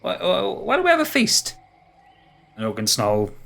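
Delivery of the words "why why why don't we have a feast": high, stuttering creature voice; high-pitched, hopping sidekick; excitable hoofed creature. Acted. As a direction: stammer the suggestion up high. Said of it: "he's kind of hopping around".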